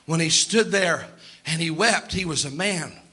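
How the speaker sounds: ordinary delivery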